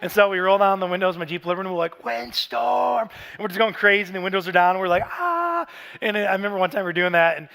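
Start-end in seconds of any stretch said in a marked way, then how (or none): 2.0-3.1 high, drawn-out game cry; playful call-out. Retold or imitated. Dad starting the windstorm game with his boys, playful high call. Dad's hurricane game in car. 5.1-5.7 long high-pitched "aah"; mock hurricane scream, held high; playful yell. Dad and boys going crazy in the car, long high playful yell.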